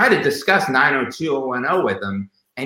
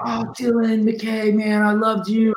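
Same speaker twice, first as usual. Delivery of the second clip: gushing fan; 9 semitones higher than usual. Retold or imitated. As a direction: play an adoring fan spotting the star. Imitating fans on the street.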